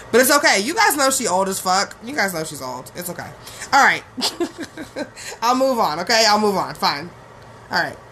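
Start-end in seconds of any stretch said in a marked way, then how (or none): 4.1-5.4 high, quiet giggle. Real, not acted. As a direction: giggle under your words' end. Laughs while trying to move on.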